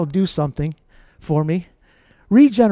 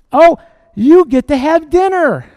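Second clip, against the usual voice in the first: mock parent reward; 4 semitones higher, 8 dB louder than usual.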